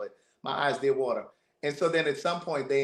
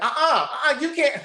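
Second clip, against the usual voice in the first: mock scolding lady; 13 semitones higher than usual. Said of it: "She's like".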